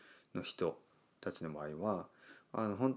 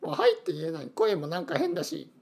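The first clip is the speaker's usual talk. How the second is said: ずっと高い声で、照れて嘆く少年; 声変わり中の恥ずかしい中学生を演じて; 恥ずかしがる自分のまね; 5 dB louder than usual. Retold or imitated. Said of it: "みたいな". (中学時代の自分をまねる、高い照れ声)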